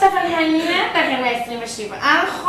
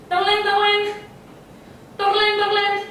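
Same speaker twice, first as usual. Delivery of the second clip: high, sung-out "ding-a-ling" bell; imitated bell ringing; 6 semitones higher than usual. Sick man ringing for unheard servants, bell imitation. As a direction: mimic a handbell, pitched up. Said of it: "אני לא מצלצל חזק מספיק".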